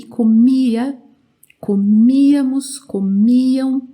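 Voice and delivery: "comia, comíamos, comiam" with a long strong MI; stress on MI. Shown as a demonstration. Teacher showing where it is always strong, stress on MI. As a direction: hit and hold MI in each of "comia, comíamos, comiam". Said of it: "sempre forte aqui no mi".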